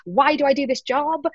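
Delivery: hammed-up worry, kept fairly light; mock panic. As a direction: play up the mounting self-doubt a little. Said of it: "panicking about it 'cause you're like".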